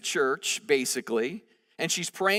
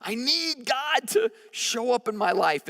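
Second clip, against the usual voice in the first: raised, loud cry of someone falling apart; a desperate woman at the tabernacle, crying out; desperate plea; 7 semitones higher, 3 dB louder than usual. Retold or imitated. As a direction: plead it out, on the edge of breaking. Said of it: "She's like".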